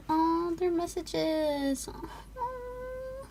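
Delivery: slow, teary voice; moved, close to tears; touched. Real, not acted. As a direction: let the voice catch. Touched by messages.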